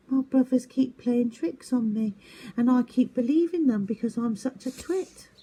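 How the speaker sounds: high, small owl voice, sheepish; a bullied little owlet owning up; young owl Twit. Acted. Owlet tells Norma his brothers trick him, high and sheepish. Twit's voice, higher.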